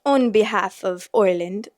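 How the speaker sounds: Irish attempt sliding into English; botched Irish accent. Shown as a demonstration. Rereading a comment for viewers, botched Irish accent. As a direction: badly fake Irish, with "Oiland" for Ireland. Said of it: "Why am I doing an English accent?".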